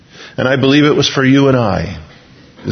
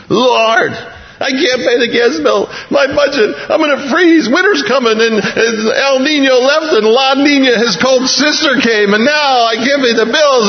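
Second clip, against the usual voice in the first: much higher, complaining pleading voice; whiny mock prayer; 9 semitones higher than usual. Worshipper whines to the Lord about bills, high and pleading.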